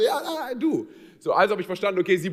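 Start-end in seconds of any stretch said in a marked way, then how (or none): 0.0-0.9 mock airport worker voice. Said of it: "und sie meint so".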